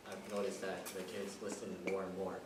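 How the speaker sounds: usual speaking voice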